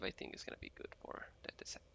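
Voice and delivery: whispering under his breath; muttered whisper. Real, not acted. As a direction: drop to a whisper, talking to yourself. Whispering to himself mid-flight.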